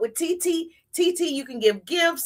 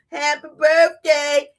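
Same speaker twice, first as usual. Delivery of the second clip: extra; 2 semitones higher, 6 dB louder, slower than usual. Shown as a demonstration. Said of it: "See, I'm special. I do things different".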